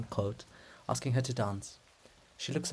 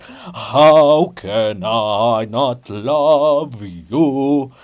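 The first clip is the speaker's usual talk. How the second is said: much louder, slow belted singing; chorus sung louder than the blast; bold loud chorus; 10 dB louder, much slower than usual. Acted. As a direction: belt it out over the blast. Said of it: "Chorus boldly ... louder than the blast".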